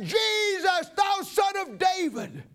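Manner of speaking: high shouted plea, slowing on the last words. Acted. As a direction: cry out again over the crowd telling him to hush. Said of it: "I cried the louder".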